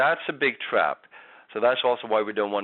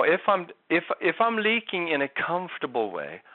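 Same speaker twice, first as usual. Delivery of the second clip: ordinary talk, nothing marked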